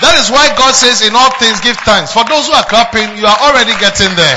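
loud fervent sermon climax; preaching at full force. Acted. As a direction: shout it to the congregation.

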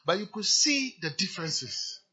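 usual speaking voice

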